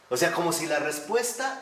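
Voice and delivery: usual speaking voice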